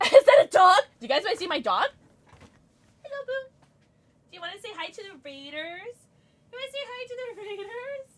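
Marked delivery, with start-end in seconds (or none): 3.0-8.1 hushed, high cooing; pet talk. Real, not acted. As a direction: coo sweetly to the dog. Baby-talking to the dog.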